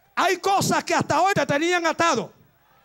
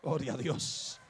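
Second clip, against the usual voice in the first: hushed praise; 14 dB quieter than usual. Real, not acted. Preacher in worship.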